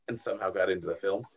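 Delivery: ordinary talk, nothing marked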